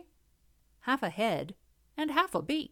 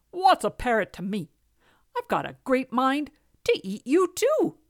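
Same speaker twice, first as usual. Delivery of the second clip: a touch louder, boastful cat threat; lightly voiced boastful cat; 5 semitones higher, 6 dB louder than usual. A narrator's reading. Cat threatens to eat the scolder, boastful. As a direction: play a scornful, greedy cat lightly. Cat's defiant retort in tale.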